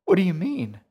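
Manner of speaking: high, loud, overwrought question; emotional, bewildered cry; sobbing with relief. A narrator's reading. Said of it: "sobbing with relief".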